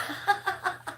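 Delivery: short high rising laugh; a quick squeaky giggle; amused high laugh. Real, not acted. Laughing at comment.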